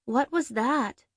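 lightly voiced girlfriend, slow; a hint of a puzzled girlfriend; young woman's voice. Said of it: "Mystery Blonde's muffled voice".

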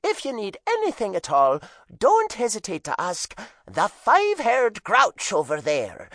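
loud, high Irish hostess; cheeky Irish mom; Irish accent. A narrator's reading. Mom's standard line.

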